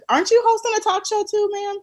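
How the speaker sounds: ordinary talk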